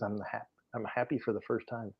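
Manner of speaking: hushed whispered confession. Retold or imitated. Mother whispering her joy to her daughter. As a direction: breathe it out softly, barely voiced.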